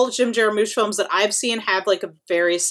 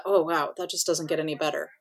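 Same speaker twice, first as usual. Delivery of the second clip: usual speaking voice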